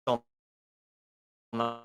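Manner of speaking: choppy, stuttering connection; robotic, breaking-up call audio; glitchy robotic audio. Real, not acted. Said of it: "[name]'s robot".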